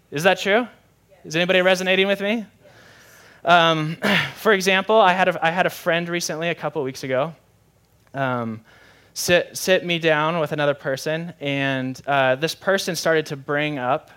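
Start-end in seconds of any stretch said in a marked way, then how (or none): none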